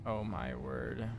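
stunned low gasp. Real, not acted. Warped by the game device.

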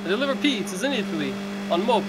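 a bad high Italian accent, hammed up; hammy pizza-guy Italian accent. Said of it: "My horrible Italian accent".

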